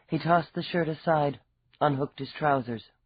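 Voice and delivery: ordinary delivery, nothing marked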